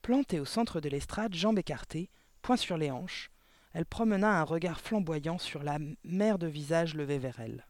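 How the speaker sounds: ordinary delivery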